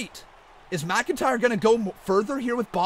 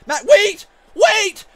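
higher, louder frantic yelling; shocked protest; 5 semitones higher, 5 dB louder than usual. Acted. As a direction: beg the interloper not to do it. Shock at surprise entrant.

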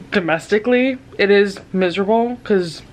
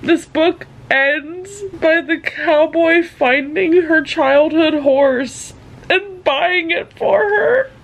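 slow, higher, choked-up telling; tearful; 7 semitones higher, slower than usual. Real, not acted. Spoiling the ending to viewers, still crying. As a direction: fight the tears as you spoil it. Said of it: "I'm crying a lot".

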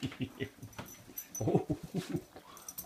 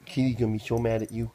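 louder playful scolding of the dog; louder teasing; 8 dB louder than usual. Real, not acted. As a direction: call it out loud to the dog, teasing.

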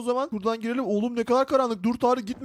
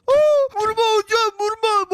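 mock pleading yelp; 7 semitones higher, 5 dB louder than usual. Pleading not to be hit.

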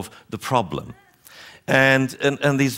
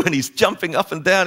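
ordinary talk

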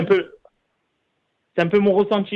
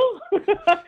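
incredulous laugh. Real, not acted. Laughs at the offer.

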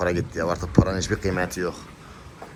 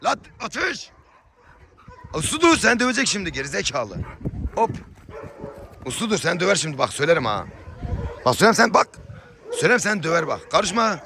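scolding the dogs; 9 semitones higher than usual. Real, not acted.

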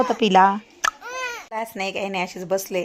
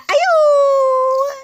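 drawn-out coo; 4 dB louder, much slower than usual. Acted. Cooing to sleepy baby.

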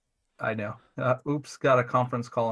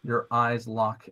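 ordinary talk

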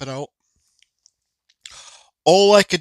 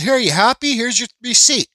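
mock-sweet sarcastic jab, a bit higher; 9 semitones higher, 5 dB louder than usual. To the cashier, handing her the slip, sarcastic. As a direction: hand it over with snide sarcasm.

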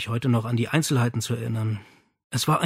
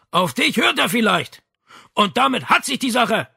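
shouting up; 10 semitones higher, 5 dB louder than usual. Acted. Father shouting, then door slams.

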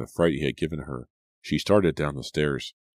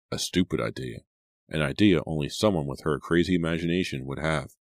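ordinary delivery, nothing marked

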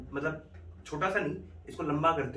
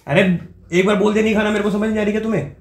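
higher and louder; faked angry shout; 7 semitones higher, 9 dB louder than usual. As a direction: act exasperated and fed up. Faked anger in ignoring prank.

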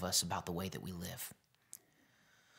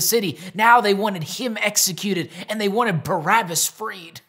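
much higher and louder, pleading preacher; preaching at full force; 10 semitones higher, 11 dB louder than usual. Acted. Pastor urging the church to live the truth, full-force preaching. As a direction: drive the truth home to the congregation.

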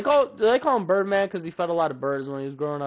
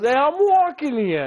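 higher, louder brash New Yorker; New York impression; 10 semitones higher, 7 dB louder than usual. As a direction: bark it at the lady like a New Yorker. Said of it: "he goes".

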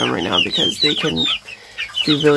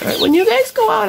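pet talk; 4 dB louder than usual. Real, not acted. Talking to the chickens.

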